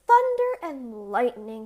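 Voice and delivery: a loud startled exclamation; tailor's cry. A narrator's reading. Tailor spotting the distant tower, crying out. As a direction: burst out in alarm. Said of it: "cried the tailor".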